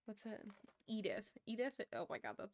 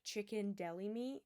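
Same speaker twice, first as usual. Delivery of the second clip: ordinary talk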